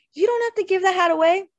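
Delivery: loud, high-pitched protest; sharp high trill. A narrator's reading. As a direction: object in a trilling, outraged little voice. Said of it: "Her voice rose sharply in its trill".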